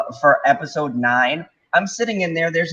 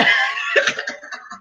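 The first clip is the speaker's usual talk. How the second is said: laugh. Real, not acted. Laughing at own story.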